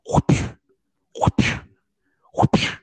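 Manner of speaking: loud whooshing punch sound effects; movie punch whoosh. Retold or imitated. Mocking cheap fight scene sound effects, loud whooshes. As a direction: whoosh like a fist flying through air. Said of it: "a fist whistling through the air".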